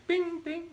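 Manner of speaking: high repeated mock "bing, bing"; ping twice like the nozzle hitting; high bing bing noise; imitated clang. Nozzle still clanging, quoted.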